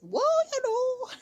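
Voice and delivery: high, loud hammed-up London accent; a squeaky over-the-top London accent; mock London accent. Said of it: "I sound like".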